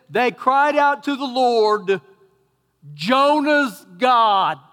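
slow, loud, drawn-out "Jonah's God"; slow emphatic reading. Shown as a demonstration. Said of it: "the reason I highlight that".

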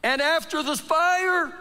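normal talking voice

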